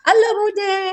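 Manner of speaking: imitating a small child's high, bright greeting. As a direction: chirp hello like a friendly little kid. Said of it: "dia mudah menyapa".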